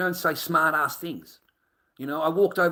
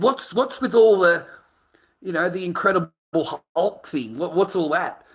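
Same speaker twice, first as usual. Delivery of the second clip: amazed question; 5 semitones higher, 6 dB louder than usual. Retold or imitated. Asking the big guy, retold.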